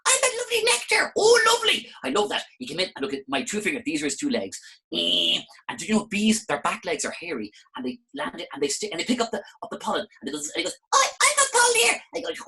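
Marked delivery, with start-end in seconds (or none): 0.1-1.9 very high, loud cheery bee; squeaky buzzing bee. Acted. Bee character speaks. 10.9-11.9 very high, loud bee; squeaky bee. Acted. Bee speaks.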